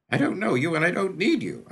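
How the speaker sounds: high, loud and defiant, pushing him away; a cornered boy refusing help; defiant kid. Acted. Kid defies the stranger.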